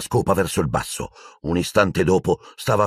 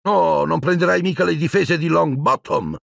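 put-on higher, sneering girl voice; mocking taunt; 9 semitones higher than usual. Pansy mocks Parvati for defending Longbottom. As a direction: needle her with scorn.

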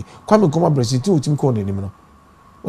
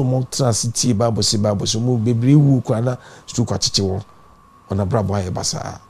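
ordinary delivery

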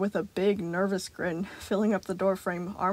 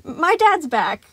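nervous; 8 semitones higher, 11 dB louder, much slower than usual. A narrator's reading.

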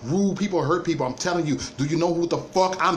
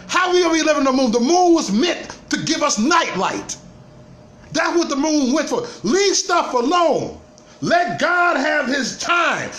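loud, much higher, slowed-down preaching shout; shouting rant; 10 semitones higher, 5 dB louder than usual. Real, not acted. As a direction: bellow it like a sermon about the moon. Shouting rant about the moon.

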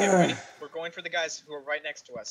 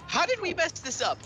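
exasperated; 11 semitones higher than usual. Real, not acted. Exasperated high.

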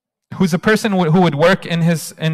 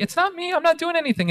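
humble denial; 11 semitones higher, 7 dB quieter, faster than usual. Acted. Humble boy deflecting praise.

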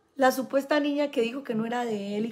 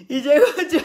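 laughing; 6 semitones higher, 9 dB louder, faster than usual. Real, not acted. Laughing mid-sentence.

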